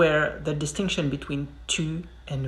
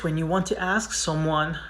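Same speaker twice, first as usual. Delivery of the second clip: ordinary talk, nothing marked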